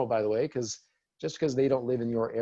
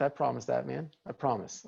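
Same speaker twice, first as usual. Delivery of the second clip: ordinary delivery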